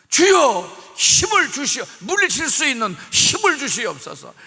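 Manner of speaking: fervent loud prayer; loud plea. Acted. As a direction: beg God for strength. Pleading prayer for strength.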